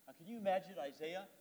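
ordinary talk, nothing marked

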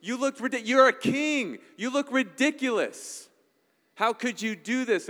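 high, loud scolding wife voice; indignant wife. Retold or imitated. Wife scolding the king for dancing, high and indignant.

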